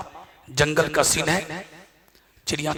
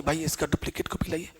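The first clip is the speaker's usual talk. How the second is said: whispering; 3 semitones lower, 13 dB quieter than usual. Retold or imitated. Retold request to counselling candidate.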